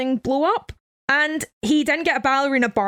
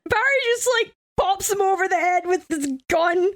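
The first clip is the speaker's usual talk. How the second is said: usual speaking voice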